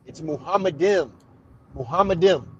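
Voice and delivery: "Muhammadim" run together as moo-HAH-muh-dim; corrective pronunciation. Shown as a demonstration. Debater correcting his opponent, "Muhammadim" as one fluent moo-HAH-muh-dim. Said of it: "That's how you say it".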